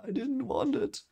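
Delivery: high, strained plea of a reluctant hero; pleading Rand. Retold or imitated. Rand pleading with his friends, high and strained. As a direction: squeeze it out high, like a confession he hates. Rand's anguished confession voiced.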